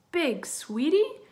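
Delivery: high, slow, loud echo with a rising end; questioning shout. A narrator's reading. Mina and Pip echo Bert's warning, questioning shout. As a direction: call it back as a puzzled question. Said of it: "shouted Mina and Pip".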